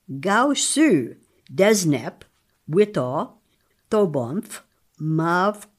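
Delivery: each word of the list said slowly and carefully, one by one; reading invented words. Shown as a demonstration. Linguist reading a word list aloud for the host, carefully. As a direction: read each made-up word out carefully, pausing between them. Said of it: "Continue with the list".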